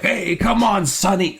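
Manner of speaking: high mock old Italian accent; lightly voiced old Italian guy calling out; old Italian man. Friends calling Sonny over, in a mock old Italian accent. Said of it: "They all sound like eighty-year-old Italian guys".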